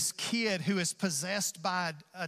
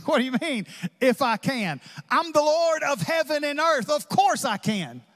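louder, higher, fast and amused; amused incredulity; 8 semitones higher, 6 dB louder than usual. Acted. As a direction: laugh it off: of course I can. Jesus voiced, amused and emphatic.

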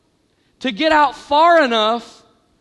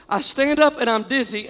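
ordinary talk, nothing marked

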